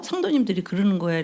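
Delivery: ordinary delivery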